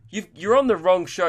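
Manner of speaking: usual speaking voice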